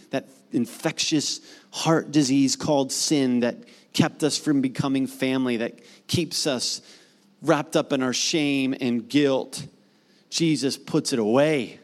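ordinary talk, nothing marked

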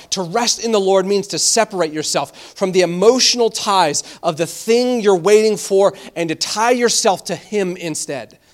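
ordinary talk, nothing marked

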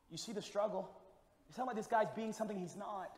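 ordinary delivery

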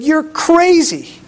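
high, slow, drawn-out disbelief; incredulous disbelief. Acted. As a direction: stretch it out as if he's lost his mind. Disciples disbelieve Jesus' plan.

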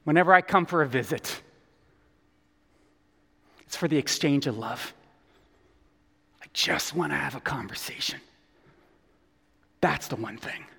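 hushed, falling to near whisper; tender Jesus. Acted. Jesus telling Martha the one thing, hushed and tender. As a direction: say it softly and intimately, with long pauses.

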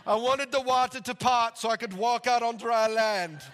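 high, loud imitation of a Ugandan accent; excited Ugandan accent. Rescued pastor telling his flood story, excited Ugandan accent. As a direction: declaim it in a Ugandan preacher's accent. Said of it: "This is an awesome accent".

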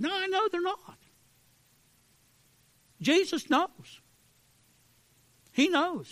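soaring, slowed preaching; preaching at full force. Acted. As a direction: draw the words out and ring them.

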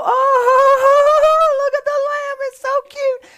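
very high, loud, cooing voice; gushing crowd. Retold or imitated. Family gathered round the newborn lamb, squealing in a very high coo. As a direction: squeal like everyone melting over a lamb. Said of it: "And everybody go".

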